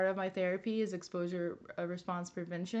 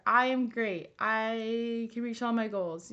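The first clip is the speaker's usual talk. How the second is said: mirror affirmation; slower than usual. Shown as a demonstration. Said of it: "you look at yourself in the mirror and you say".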